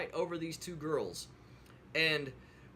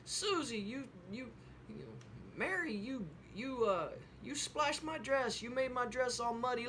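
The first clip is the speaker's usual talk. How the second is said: put-on high, upset child's whine; pouty high child voice, scolding her friend; angry little girl; 7 semitones higher than usual. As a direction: play the furious little girl over her ruined dress. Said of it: "she got so mad at her".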